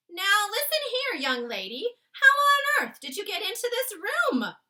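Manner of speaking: very loud, high furious maid; bellowing at the girl at the window; furious shout. Acted. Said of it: "the maid shouted furiously".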